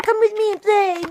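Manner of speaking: put-on high, loud sing-song kid voice; playful little girl. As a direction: coax him over like a small child. Little girl chasing boy.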